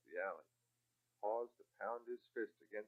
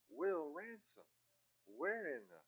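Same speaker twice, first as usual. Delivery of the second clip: surprised; 8 semitones higher, slower than usual. A narrator's reading. Surprised sister recognizes brother.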